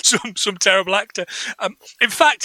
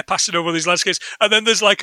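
usual speaking voice

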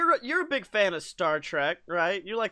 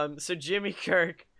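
ordinary delivery

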